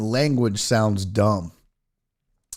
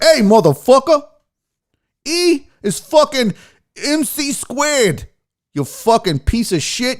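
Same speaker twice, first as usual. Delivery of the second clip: hammed-up tough-guy New York voice; tough New Yorker; 7 semitones higher than usual. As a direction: bark it like a pushy New Yorker. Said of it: "if you had, like, a New Yorker, be like".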